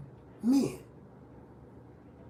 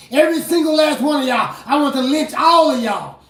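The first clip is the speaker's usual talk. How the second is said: loud, raised-pitch pulpit shouting; angry preaching tirade; 6 semitones higher, 7 dB louder than usual. Real, not acted. Preacher venting at hate groups, shouted angrily. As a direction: build to a full-force furious rant.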